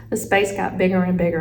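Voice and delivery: plain reading voice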